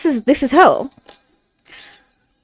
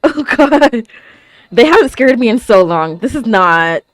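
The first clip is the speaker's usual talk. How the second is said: ordinary talk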